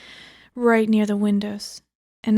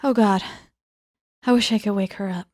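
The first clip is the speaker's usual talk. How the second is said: normal talking voice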